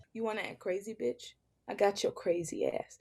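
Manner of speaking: low, soft threatening male voice; menacing man. Acted. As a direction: growl a quiet threat.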